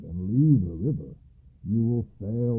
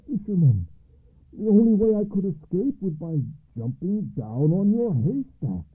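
high, louder eager rush; excited telling; 7 semitones higher, 5 dB louder than usual. A narrator's reading. Alba tells Andre about the ghostly ride, excited. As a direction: blurt out the wonder.

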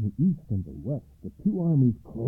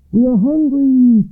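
high, loud hungry shout; soldiers crying out; 12 semitones higher, 12 dB louder than usual. A narrator's reading. Hungry army to its general, loud high cry. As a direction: cry out loudly, pitched way up. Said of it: "cried all the soldiers".

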